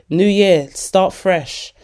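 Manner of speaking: ordinary delivery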